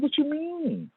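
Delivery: high, puzzled voice of himself confessing; innocent confused self. Retold or imitated. Comic pitching his innocent confession to an angry friend. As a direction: play it baffled and hurt, voice up high. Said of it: "And I'm like".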